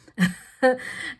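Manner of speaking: big relieved laugh; loud burst of laughter. Real, not acted. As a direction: laugh out loud after a scare. After lash scare.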